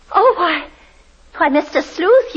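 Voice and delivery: gasping, high, startled cry; shocked at the pictures; high breathless alarm. Acted. Landlady shocked by turned pictures.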